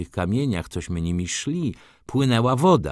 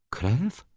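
"Krew" with a rising question tune; questioning echo; 3 semitones higher, 4 dB quieter than usual. A narrator's reading. Izabela repeats the startling word, questioning rise on "Krew". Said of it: "powtórzyła".

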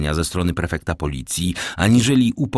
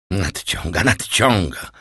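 mildly testy echo; bristling irritation. A narrator's reading. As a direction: repeat the word with a touch of annoyance. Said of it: "żachnął się Ludwik osiemnasty".

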